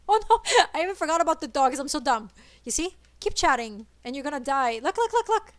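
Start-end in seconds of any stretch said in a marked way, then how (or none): 0.1-2.3 fast, louder, higher burst of dismay; rapid flustered oh no; flustered realization. Real, not acted. Realizes she forgot the dogs. 4.8-5.5 much higher, louder, rapid repeated look; high excited chant of look. Real, not acted. Excited during boss fight.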